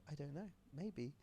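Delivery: soft, slow motherly answer; gentle mum. Acted. Mum answering her sad child, soft and slow. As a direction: answer softly and slowly, pitched a little up. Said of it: "And the mum's like".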